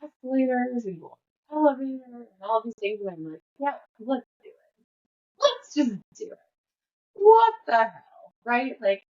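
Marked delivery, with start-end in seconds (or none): 3.6-8.3 half-joking let's-go enthusiasm that peaks on what the hell; mock reckless enthusiasm. Mom going along with son.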